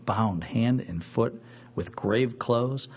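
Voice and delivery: normal talking voice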